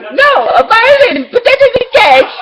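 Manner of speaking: hammed-up high villain voice; Puppetmon voice. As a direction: squeak out the punchline like a cartoon puppet. Puppetmon answers riddle.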